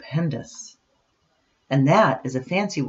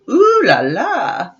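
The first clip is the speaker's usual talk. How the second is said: slow, louder, hammed-up French flourish; mock posh ooh la la; 8 semitones higher, 7 dB louder, slower than usual. Nancy admiring her posh family, slow mock-French flourish. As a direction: savour it like a grand lady.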